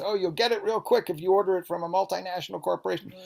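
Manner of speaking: high-pitched, quick put-on voice; mock tempting voice. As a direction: play the bad story's sales pitch, high and fast. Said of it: "the bad story that whispers in your ear and says".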